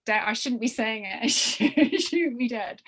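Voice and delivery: ordinary talk, nothing marked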